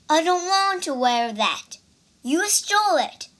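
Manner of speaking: indignant refusal. Acted. Monk refuses accusingly.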